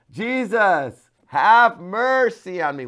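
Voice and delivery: loud, high, slow plea; crying out. Retold or imitated. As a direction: beg Jesus for mercy. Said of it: "He said".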